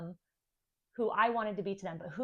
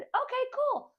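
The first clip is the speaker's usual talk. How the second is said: casual okay; 8 dB louder than usual. Retold or imitated. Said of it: "And I said".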